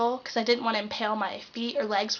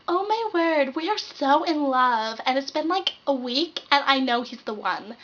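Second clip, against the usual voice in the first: lovestruck gushing; 3 semitones higher, 4 dB louder, slower than usual. Retold or imitated. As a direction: gush like a teen girl newly in love. Gushing girl in new relationship.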